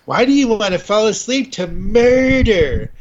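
high-pitched, put-on disbelief; mock incredulous. People questioning a true-crime sleep habit, mock incredulous. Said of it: "the first thing people will say".

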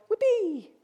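high, put-on cheer; a token cheer for someone else's party; mock celebration. Family member expected to celebrate the returner, mock cheering. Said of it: "you're just expected to go".

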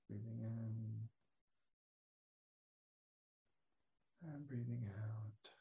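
soft, slow cues fading toward a whisper; guided breathing. Shown as a demonstration. Teacher leading the butterfly breath, soft and fading. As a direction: guide each breath quietly, easing off at the end. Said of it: "the butterfly breath".

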